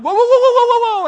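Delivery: high, loud panicked whoas; alarmed whoa. Retold or imitated. Grandfather stopping grandkid grabbing a cleaver, loud alarm. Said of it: "I'm like".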